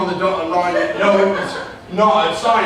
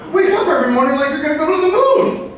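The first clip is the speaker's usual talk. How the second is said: rallying; 9 semitones higher than usual. Real, not acted. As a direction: fire them up to go to the moon. Rallying the audience.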